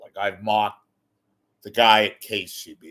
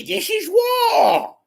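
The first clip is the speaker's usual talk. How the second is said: slow, drawn-out high shout; hammed-up slow war shout; mock war cry; 6 dB louder, much slower than usual. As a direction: bellow it like a battle cry. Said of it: "I could be like Carville".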